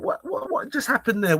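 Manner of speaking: high, stuttering disbelief; baffled indignation. Retold or imitated. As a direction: replay your stunned, fuming thoughts. Retells his baffled angry thoughts.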